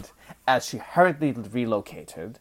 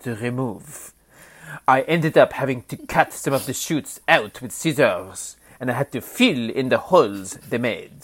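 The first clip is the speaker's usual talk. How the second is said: hammed-up fake French accent; mock French accent, a bit louder; bad French accent; 3 dB louder than usual. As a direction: read Donna's quote in a clumsy French accent. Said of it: "pardon my horrible French accent".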